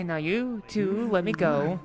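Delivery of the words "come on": normal talking voice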